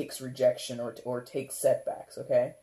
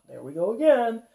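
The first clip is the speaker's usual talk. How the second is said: weary sarcasm; 9 semitones higher, 6 dB louder than usual. Retold or imitated. Voicing a resigned dater.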